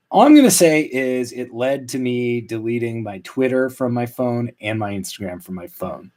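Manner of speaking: usual speaking voice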